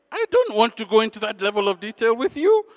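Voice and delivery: loud, high-pitched protest; acted agitation; exasperated refusal. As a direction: refuse impatiently, voice pushed up.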